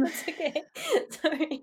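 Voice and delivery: laughing high, reassuring; giggly apology. Real, not acted. Host laughs with guest.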